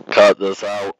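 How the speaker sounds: loud order. Real, not acted. Reader marks the recording for editing, loud order. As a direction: boom it out as an editing note.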